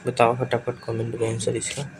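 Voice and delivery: ordinary talk, nothing marked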